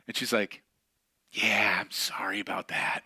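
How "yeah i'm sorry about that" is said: slow, whispered mom apology; sheepish whisper. Retold or imitated. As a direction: mumble the sorry under your breath.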